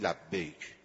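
low, soft reply from above; hushed, deep response; God's gentle answer. Retold or imitated.

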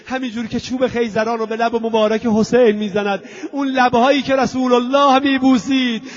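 real grief taking over mid-sentence; tearful lament. Real, not acted. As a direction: voice climbing into sobs. Preacher breaks down lamenting Husayn.

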